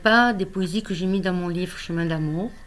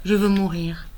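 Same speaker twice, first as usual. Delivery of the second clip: higher, louder, pleading love poem; impassioned poetry recital; 5 semitones higher, 9 dB louder than usual. Acted. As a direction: call out to the beloved in verse. Love poem builds in appeal. (poème d'amour déclamé avec ferveur)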